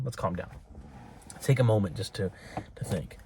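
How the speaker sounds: soft and hushed, settling down; calming down. Real, not acted. Settling viewers after an urgent warning, softly and calmly. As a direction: ease off into a quiet, calm voice. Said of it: "now we can calm down".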